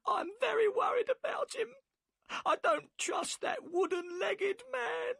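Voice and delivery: weep out her fear for her husband; sobbing worry. A narrator's reading. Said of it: "and burst into tears".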